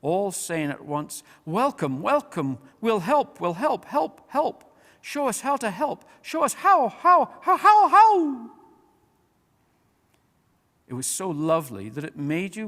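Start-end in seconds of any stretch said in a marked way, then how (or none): 1.4-8.5 ever higher and louder eager dog voices; joyous barking dogs. A narrator's reading. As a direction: bubble over with doggy joy, building to a yelp. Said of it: "barking joyously".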